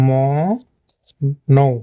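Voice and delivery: "ম-, নৌ" as two separated syllables; syllable-by-syllable pronunciation. Shown as a demonstration. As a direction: draw out "ম-", pause, then "নৌ" with a gliding vowel. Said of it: "কিন্তু এটা হবে হলো আপনার".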